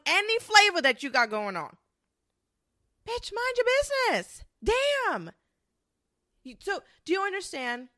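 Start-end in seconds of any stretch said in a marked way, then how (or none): 3.1-5.3 much higher, shrieking tell-off; exasperated outburst. Acted. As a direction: throw up your hands and shriek it at her.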